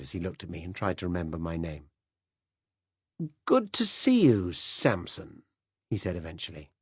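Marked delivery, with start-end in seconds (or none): 3.4-5.4 put-on higher, slow, dithering upper-class old man; a fussy, absent-minded British gent apologizing; hesitant upper-class old man, drawn out; vague old Director General.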